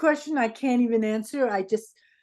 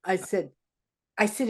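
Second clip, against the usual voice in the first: ordinary talk, nothing marked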